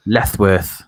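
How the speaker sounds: Lethworth with every s lisped as th. Shown as a demonstration. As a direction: say Lethworth with a deliberate lisp. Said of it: "You have to say it with lisp".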